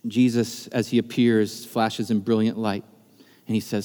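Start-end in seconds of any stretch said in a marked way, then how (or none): none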